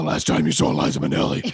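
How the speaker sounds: low, gruff hero growl; low menacing voice. Acted. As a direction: loom over him in a low voice. Superhero interrogating, then repeats.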